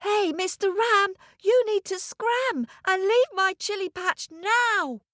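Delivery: put-on high-pitched little boy's call, no real volume; boy shouting. Little boy orders the ram off his chili patch, shouting. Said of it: "so he shouted".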